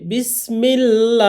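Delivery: বিসমিল্লাহ slow with light lam and a clear আ; thin lam. Shown as a demonstration. Teacher repeats the thin-lam example. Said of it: "বাংলাতে আকার দিয়ে পড়তে হবে".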